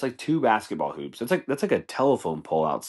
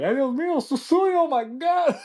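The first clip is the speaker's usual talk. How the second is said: very high Spanish-speaking wail; shrieked imitation of horrified churchgoer; mock freaking out; 4 dB louder than usual. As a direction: cry out in hammed-up panic. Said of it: "Everyone's just freaking out".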